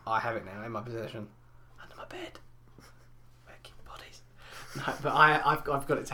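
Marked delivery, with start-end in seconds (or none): 1.8-2.4 breathy hushed spooky murmur; creepy whisper. Pretend. Host to co-host, mock-creepy whisper. 3.5-4.2 creepy whisper. Pretend. Joking creepy aside.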